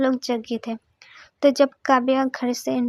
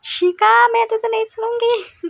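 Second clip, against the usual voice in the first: high, loud furious yell at her friend; angry scream; 8 semitones higher, 4 dB louder than usual. A narrator's reading. Girl screaming at the friend who pranked her, furious. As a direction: scream the name in rage. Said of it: "चीख के बोलती है".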